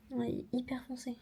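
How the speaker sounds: whispered remark about the dark shade; soft breathless whisper. Real, not acted. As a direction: whisper it to yourself.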